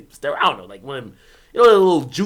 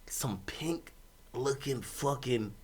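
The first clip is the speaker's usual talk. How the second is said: slow, softer disgusted hush; 5 semitones lower, 13 dB quieter, slower than usual. Real, not acted. Describing what he saw, slow disgusted hush. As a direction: drag it out in disgust.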